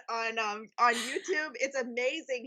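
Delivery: normal talking voice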